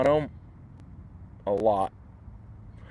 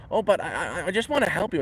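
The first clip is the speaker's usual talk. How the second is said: mock Spanish accent; 7 semitones higher than usual. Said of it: "he did, like, a Spanish accent".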